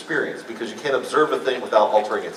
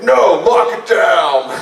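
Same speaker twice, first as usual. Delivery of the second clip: loud, high, drawn-out shout; urgent shouted order; 5 semitones higher, 9 dB louder, much slower than usual. Retold or imitated. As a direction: shout the order slowly across the room. Retold order to stop fog machine.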